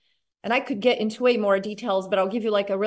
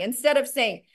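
normal talking voice

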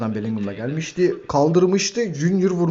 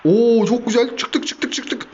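excited cheer; 9 semitones higher, much slower than usual. Real, not acted. Happy about breaking out.

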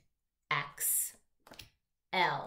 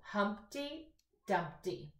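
slow read-aloud; 3 semitones higher, 4 dB quieter, much slower than usual. Shown as a demonstration.

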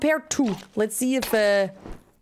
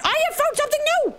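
excited squeal; 13 semitones higher, faster than usual. Real, not acted. Excited discovery in game.

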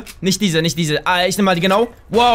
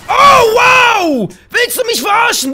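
loud, much higher outraged yell; furious shout; 12 semitones higher, 6 dB louder, slower than usual. Real, not acted. Gamer yelling at an enemy player, furious shout. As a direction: blast out real disbelief and rage.

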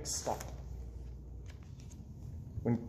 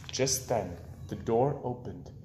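plain reading voice

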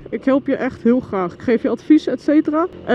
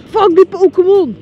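high, loud mock-angry shout; joking shout at a Pokémon; playful outburst; 9 semitones higher, 8 dB louder than usual. Real, not acted. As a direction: yell at the Pokémon in jest. Spotting a Pokémon while riding.

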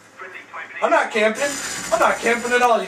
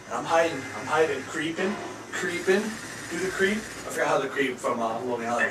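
softer, sneaking half-voice; sneaky hushed; 4 semitones lower, 8 dB quieter than usual. Acted. Gamer creeping through the map, softer hushed voice. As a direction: hush down like you're hiding.

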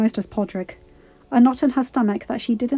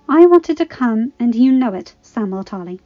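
firm; 2 semitones higher, 7 dB louder than usual. A narrator's reading. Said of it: "Gilly responded firmly".

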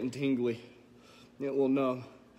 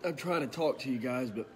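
burning throat; 7 dB quieter than usual. Real, not acted. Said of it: "my throat is on fire".

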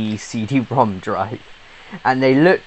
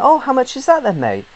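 surprised quote; 13 semitones higher, 4 dB louder than usual. Retold or imitated. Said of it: "I'm like".

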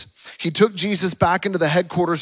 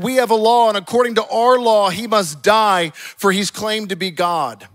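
loud, raised, insistent declaration; acted shouting; 6 semitones higher, 8 dB louder than usual. Priests pressing their law on Pilate, acted shouting. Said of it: "the leading priests and the temple guards began shouting".

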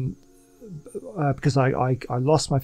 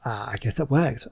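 normal talking voice

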